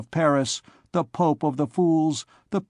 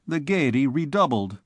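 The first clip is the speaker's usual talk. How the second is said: plain reading voice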